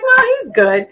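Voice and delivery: high, breezy reassurance; imitating the sister's high breezy reply; reassuring sister. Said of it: "She was like".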